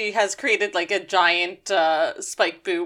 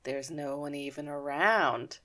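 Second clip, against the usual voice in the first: low, slow, hushed menace; quiet, drawn-out plotting voice; sinister realization; 5 semitones lower, 10 dB quieter, slower than usual. Retold or imitated. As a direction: let it dawn darkly, very softly. Retold dawning sinister realization.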